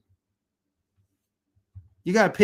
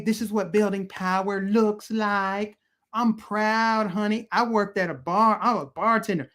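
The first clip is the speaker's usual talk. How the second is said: mocking AOC; 4 semitones higher than usual. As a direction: parody her trying to sound relatable. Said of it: "where she's like".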